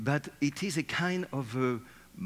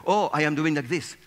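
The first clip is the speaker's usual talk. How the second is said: mock panic; 4 semitones higher, 7 dB louder than usual. Legs voiced in panic under table.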